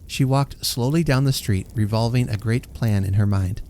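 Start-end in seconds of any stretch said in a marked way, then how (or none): none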